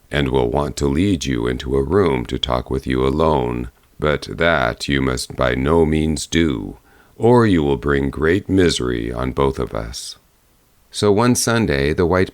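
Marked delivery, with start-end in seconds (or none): 0.1-10.2 low, grave bear voice; low bear warning. A narrator's reading. Bear warning the girl about her mother, low and grave.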